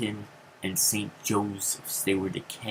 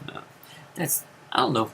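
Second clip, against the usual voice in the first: normal talking voice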